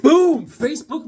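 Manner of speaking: loud high boom; excited reveal. Real, not acted. Collector revealing his comic to viewers, loud and high. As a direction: slam it down like a reveal.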